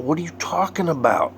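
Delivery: the second ask climbs in shock; rising disbelief. Retold or imitated. Stunned demand, higher repeat.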